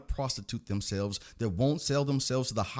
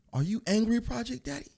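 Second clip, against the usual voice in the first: higher mocking voice of a critic; mock questioner; 10 semitones higher than usual. A critic asking the ranting host, in a higher mock voice. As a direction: needle him like a pearl-clutching viewer. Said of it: "You wanna ask me".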